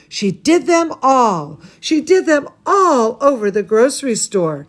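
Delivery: usual speaking voice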